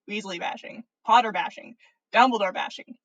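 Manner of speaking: plain reading voice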